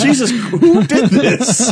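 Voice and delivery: high, slow laughing disbelief; cracking up in shock. Real, not acted.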